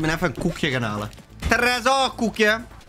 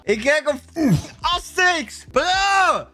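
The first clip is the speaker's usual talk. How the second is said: high-pitched, incredulous wail; appalled outcry; 9 semitones higher, slower than usual. Real, not acted. Streamer sees himself looking like Asterix, appalled outcry. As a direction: let the voice leap up in horror.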